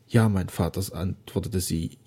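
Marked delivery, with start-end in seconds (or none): none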